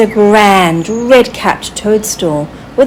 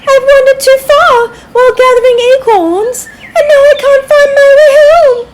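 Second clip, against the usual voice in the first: high squirrel voice, rushing a bit at the end; a lost little squirrel pouring out his worry; high, hurried squirrel voice; 5 dB louder, faster than usual. A narrator's reading. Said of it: "he said".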